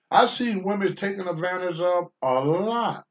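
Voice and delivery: ordinary talk, nothing marked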